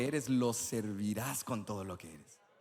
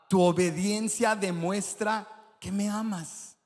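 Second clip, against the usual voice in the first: loud, much higher sermon climax over music; preaching at full force; 8 semitones higher, 7 dB louder than usual. Acted. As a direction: drive the point home to the crowd.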